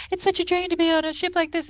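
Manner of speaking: high, sugary sweet talk; sweet-talking woman. Acted. Holodeck woman sweet-talking Riker, high and flirtatious. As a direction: put on a high flirtatious lady's voice. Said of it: "they're just sweet talking to each other".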